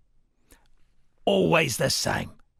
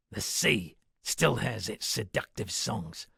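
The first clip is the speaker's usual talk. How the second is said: ordinary delivery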